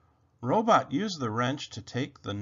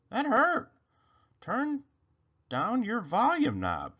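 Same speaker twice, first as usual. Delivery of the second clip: high, aggrieved bunny complaint; Rabbit voice; 9 semitones higher than usual. Acted. Rabbit telling Robot to turn down his volume, high bunny voice. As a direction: scold gently in a small high voice.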